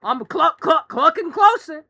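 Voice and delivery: high, loud Southern chicken; stuttering cluck in a Southern rooster voice; Foghorn Leghorn. Acted. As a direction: menace them as a hammed-up cartoon chicken. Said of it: "chicken with a high southern accent".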